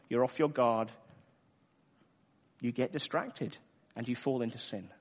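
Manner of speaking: ordinary talk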